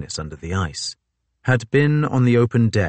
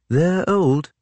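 Fitzjames voice; 10 semitones higher than usual. A narrator's reading.

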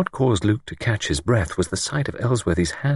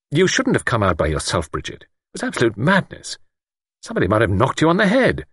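irritated scolding; irritable; 5 semitones higher than usual. A narrator's reading. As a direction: scold with rising pitch and irritation. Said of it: "Luke said irritably".

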